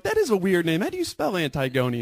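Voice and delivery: high, mock office-lady voice; imitating puzzled ladies. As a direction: play the baffled coworkers, pitched well up. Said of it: "And the ladies are like".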